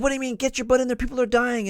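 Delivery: high, loud, mean barking; mean fierce drill-officer shout; fierce officer yell. Retold or imitated. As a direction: bark at him to get moving. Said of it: "this mean, fierce voice".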